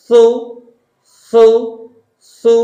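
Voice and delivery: "সু" as thu, lips rounded; tongue-out THU. Shown as a demonstration. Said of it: "জিহ্বা দেখা যাবে হালকা". (জিহ্বা হালকা দেখিয়ে "সু")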